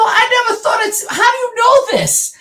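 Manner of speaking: very high, loud disbelief; astonished squeal. Retold or imitated. Reenacting her first visit to the new church, astonished squeal. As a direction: squeal it out, replaying your amazement.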